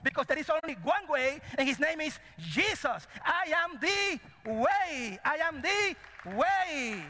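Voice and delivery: high, forceful preaching cry; Christ's proclamation. Acted. Preacher as Christ crucifying your way, a high ringing proclamation. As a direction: declare it with full authority, stretching the key words. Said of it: "Christ says".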